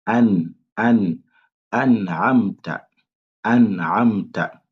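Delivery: an'amta avec le n bien clair, lent; détacher le noun de an'amta; noun bien prononcé. Shown as a demonstration. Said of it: "il faut bien prononcer le noun". (an'amta, noun net et lent)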